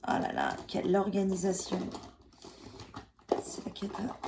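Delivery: usual speaking voice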